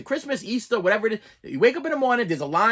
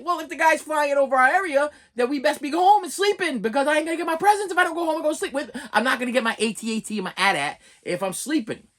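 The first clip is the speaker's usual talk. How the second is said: put-on higher, fast kid reasoning; excited kid voice; 7 semitones higher than usual. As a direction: argue it like a worried kid. Kid arguing about Santa.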